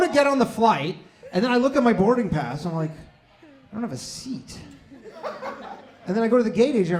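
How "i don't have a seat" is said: dismayed. Retold or imitated. Reading boarding pass, dismayed.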